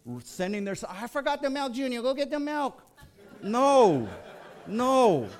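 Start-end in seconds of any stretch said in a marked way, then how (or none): 0.9-2.8 high mock voice of a careless shopper, with a laugh; hammed-up airy customer sending her kid off, much higher. Pastor mocking a customer in the express lane, high and hammed-up. 3.4-5.3 exasperated no. Real, not acted. Reacting to his own story.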